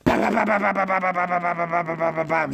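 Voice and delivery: long high burst of bam bam bam; rapid gun noises. Pretend. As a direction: rattle off mouth machine-gun fire. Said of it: "Readying his gun noise".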